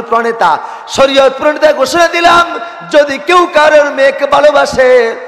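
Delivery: high, loud preacher's shout; proclamation at full force. Acted. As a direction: proclaim it like a decree, voice soaring. (উঁচু গলায় জোরালো ঘোষণা)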